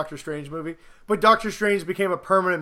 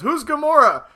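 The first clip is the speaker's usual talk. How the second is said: imitating Iron Man; 8 semitones higher, 5 dB louder than usual. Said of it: "And Iron Man goes".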